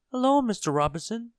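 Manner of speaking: high, loud little call; tiny Mister Small calling. A narrator's reading. Small man calling up to Mister Robinson, high and loud.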